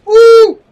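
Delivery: high, loud whoop of triumph; victory whoop. Real, not acted. Gamer celebrating a kill, loud whoop. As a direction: let out a big whoop.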